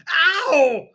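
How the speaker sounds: loud, high yelp of pain; screaming in pain. A narrator's reading. Father screaming as wife yanks glued hat, loud high yelp. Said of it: "he screamed".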